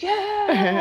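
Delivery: high, loud mock cheer; imitated cheering. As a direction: whoop it like an elated supporter. Cheer she expected from fiance.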